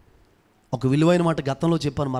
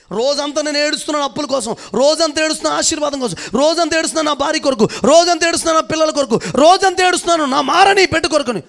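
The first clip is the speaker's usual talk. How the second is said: building, higher and louder litany; fiery preaching; 10 semitones higher, 7 dB louder than usual. Acted. Pastor voicing a believer's all-day crying, climbing fiery preaching. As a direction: pile each cry on the last, rising to a peak.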